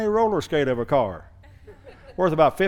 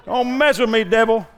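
defiant shout; 7 semitones higher, 5 dB louder than usual. Acted. Preacher defies the devil.

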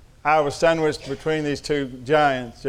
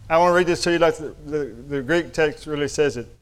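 normal talking voice

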